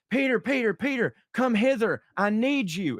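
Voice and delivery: Jesus calling out. Acted. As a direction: summon him one final time. Jesus calls a last time.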